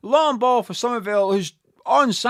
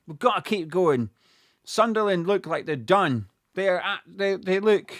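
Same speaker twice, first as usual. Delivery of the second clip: normal talking voice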